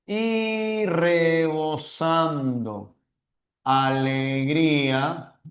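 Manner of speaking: slow, drawn-out recitation with long pauses; poem recital. Acted. Teacher reciting the example poem to the class, slowly. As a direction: savour each word of the verse.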